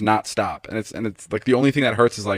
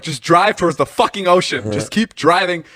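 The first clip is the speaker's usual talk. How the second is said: raised, louder, emphatic phone plea; retold urgent directing; 7 semitones higher, 4 dB louder than usual. Stranded runner directing his ride, urgent insisting. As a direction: re-enact shouting directions down the line.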